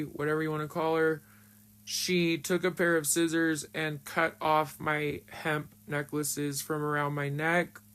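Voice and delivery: ordinary delivery, nothing marked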